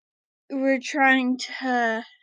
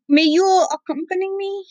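higher, lighter princess voice, asking sweetly; Cotton's shy voice; 5 semitones higher than usual. Acted. Grandpa voicing Cotton asking him along, high and shy. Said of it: "Yeah, she said".

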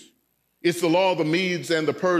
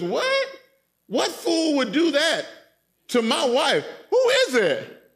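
much higher, laughing disbelief; mock-astonished king, pitch leaping up; shocked king; 8 semitones higher than usual. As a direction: squeal out the king's outrage.

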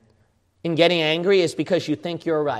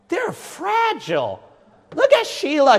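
marvelling retold reaction; 11 semitones higher than usual. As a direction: stretch it out in a high, delicate voice. Voices his reaction to sheep.

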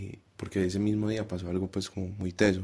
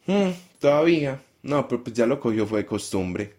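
higher, louder offhand mother; a mother waving away her daughter's grief, loud and higher; dismissive mother; 5 semitones higher, 6 dB louder than usual. Retold or imitated. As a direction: brush it off like an indifferent mom. Mother shrugs off girl's crying.

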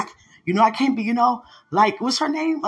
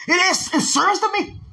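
high, emphatic protest; heated insistence; 9 semitones higher than usual. Acted. Preacher answering calls to calm down, insistent. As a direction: insist on it, worked up.

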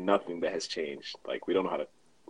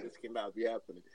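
stunned disbelief; 10 dB quieter than usual. Retold or imitated. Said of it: "I'm just like".

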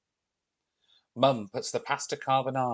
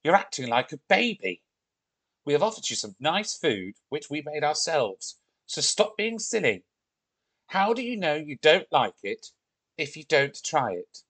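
higher-pitched, cross telling-off; angry scolding; 4 semitones higher than usual. Acted. Olivia scolding the Viking over dinner, cross. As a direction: scold him like a misbehaving baby. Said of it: "feel a little bit angry".

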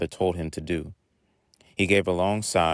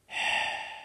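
long airy voiceless whisper; whisper in the wind; 5 dB quieter than usual. A narrator's reading. Tree calls the boy on the wind, ghostly whisper. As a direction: breathe it out slowly like a gust. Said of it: "rushes at his face with a whisper".

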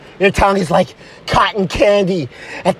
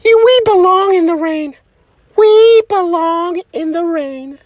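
swooning high sweetheart voice; romantic girlfriend; 6 semitones higher than usual. Acted. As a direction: proclaim it like a melodramatic heroine.

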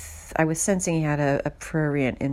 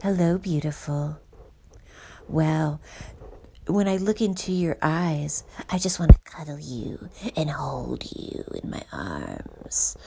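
breathy hushed sweet-talk, half whispered; hammed-up lover voice, going to a whisper; imitating a creepy match breathing sweet nothings; mock seductive suitor. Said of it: "He was like".

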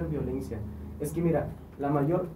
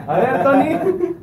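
laughing call; 9 semitones higher, 10 dB louder, slower than usual. Real, not acted. Host calls on guest, laughing.

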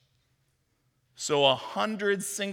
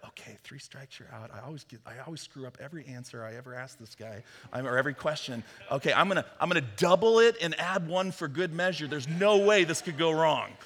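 Peter muttering to himself. Retold or imitated. Peter talks himself into an answer, muttering. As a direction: mumble it like you always get it wrong. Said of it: "and then Peter's like".

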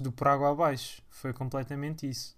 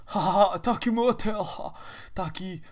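mock youtuber laugh; 3 semitones higher, 4 dB louder than usual. Impersonates youtuber laughing at hotel.

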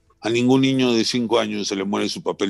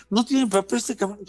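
put-on high, jeering taunt of children; mocking schoolkids; 10 semitones higher than usual. Schoolkids taunting the fatherless boy, high mocking jeer. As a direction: sneer it like a kid in the schoolyard. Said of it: "se reían".

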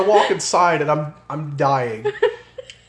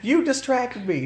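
drawn out, pitched-up accusing mom; slow, higher mock blame; 10 semitones higher, slower than usual. Daughter imitates mom blaming her for the forgotten car, slow and higher. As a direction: drag out the blame like an indignant mom. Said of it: "and she's like".

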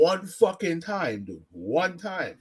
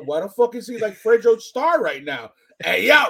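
mock shouting; 5 semitones higher, 7 dB louder than usual. Imitating the other guy's reaction.

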